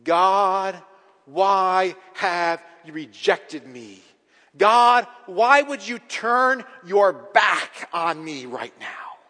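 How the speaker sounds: slow, loud plea hammered word by word; crying out. Retold or imitated. Preacher voicing the forsaken Jesus, loud anguished cry. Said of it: "As he would cry out".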